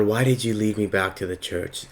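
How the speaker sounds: normal talking voice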